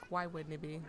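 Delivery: normal talking voice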